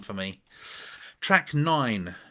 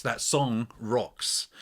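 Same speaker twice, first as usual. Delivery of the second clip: slow emphasis; much slower than usual. Real, not acted. Emphatic praise of song.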